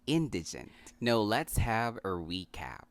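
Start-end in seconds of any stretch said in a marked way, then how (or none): 0.1-0.7 "indigent" as IN-dih-junt; short middle i in "indigent": IN-dih-junt; correct pronunciation. Shown as a demonstration. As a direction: stress the first syllable of "indigent": IN.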